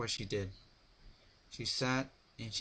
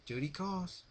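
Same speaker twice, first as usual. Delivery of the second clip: airy lady voice; 9 semitones higher than usual. A narrator's reading. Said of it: "stopped at the moment for a giggle".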